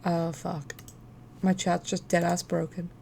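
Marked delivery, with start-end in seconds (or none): none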